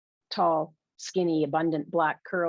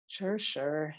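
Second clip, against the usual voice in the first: disdainful; 6 dB quieter than usual. Acted. Roommate taking a phone message from Ava, disdainful. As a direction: brush the caller off with mild disdain. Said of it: "says the disdainful voice".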